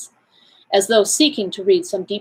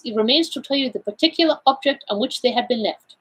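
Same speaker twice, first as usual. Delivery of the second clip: put-on higher-pitched accented detective, explaining; Poirot, self-assured; 6 semitones higher than usual. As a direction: play the Belgian detective laying out his findings. Figure of Poirot in read story.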